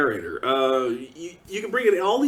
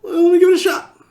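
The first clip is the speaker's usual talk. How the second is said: high, loud put-on voice; mock hopeful try; 12 semitones higher, 7 dB louder, faster than usual. Speaker before clicking the free animation, high mock hope. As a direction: act like you're giving it a fair chance. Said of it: "and I went".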